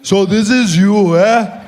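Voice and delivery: put-on slow, loud, heavy-accented; Malema impression. Malema greeting the comedian, slow and loud. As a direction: drag each word, teasing.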